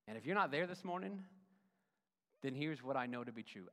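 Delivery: normal talking voice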